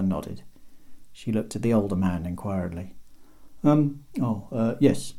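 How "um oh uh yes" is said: plain reading voice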